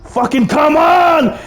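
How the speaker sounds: high, slow furious yell at traffic; angry shout. Retold or imitated. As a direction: scream it out at the red light, drawn out. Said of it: "he just starts honking".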